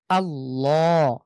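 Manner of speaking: আল্লাহ with a full, thick, dark l, drawn out slowly; heavy Allah. Shown as a demonstration. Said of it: "এখানে একদম সোজা লর মতো উচ্চারণ হবে".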